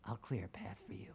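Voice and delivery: hushed, unvoiced promise; whisper. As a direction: whisper it like a secret. Jake whispers over radio.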